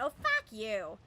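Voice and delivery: put-on Oh, fuck you in a loud, full Boston accent. Friend cut off in traffic swearing, Boston accent. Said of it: "full Boston accent".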